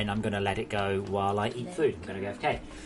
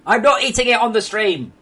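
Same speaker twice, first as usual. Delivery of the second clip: loud, high protest; exasperated shout; 10 semitones higher, 13 dB louder than usual. Real, not acted. Streamer refusing to eat for viewers, exasperated shout. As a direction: shout a firm refusal at the audience.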